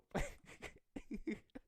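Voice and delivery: helpless, high laughter; laughing fit. Real, not acted. Streamer doubting the article is true, helpless laughing. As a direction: crack up, gasping with laughter.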